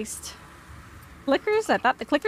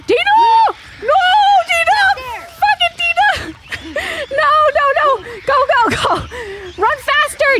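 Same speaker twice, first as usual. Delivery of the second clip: very high, loud frantic yelling; panicked screaming; 9 dB louder than usual. Real, not acted. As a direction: scream through a frantic chase. Panicked chase in game.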